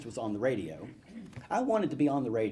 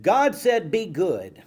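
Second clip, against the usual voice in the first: child playing preacher; 2 semitones higher, 5 dB louder, slower than usual. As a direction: proclaim it like a tiny pulpit preacher. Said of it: "I'd try to preach".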